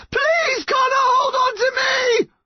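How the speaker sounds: very high, wailing plea; terrified scream. A narrator's reading. Mum slipping from her son's grasp, screaming in terror. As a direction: scream for your life. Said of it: "His mum screamed in terror".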